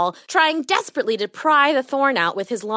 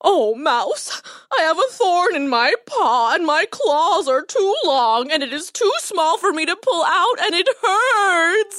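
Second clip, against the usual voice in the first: high, slow, whining wail; tearful lion; 8 semitones higher than usual. A narrator's reading. Lion telling the mouse about the thorn, wailing. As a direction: moan and whimper through the pain. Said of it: "his anguished wails".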